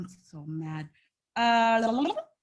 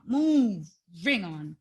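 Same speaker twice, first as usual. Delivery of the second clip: "Moving on" stretched out slowly, lower and final; slow drawn-out moving on; 4 semitones lower, much slower than usual. Shown as a demonstration. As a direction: drag "Moving on" out like closing the topic for good.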